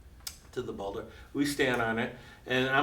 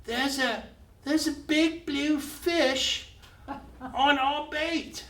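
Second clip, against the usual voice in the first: high-pitched slow call-out, much higher; mock yell; 12 semitones higher, slower than usual. Maria calls from the boulder, a high drawn-out mock yell. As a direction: stretch it out like a lookout calling. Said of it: "Maria yells out".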